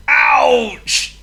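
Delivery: loud, high cry of hurt; pained yell. A narrator's reading. Burglar hitting his head on the floor, pained yell. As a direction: yelp it, drawing the word out.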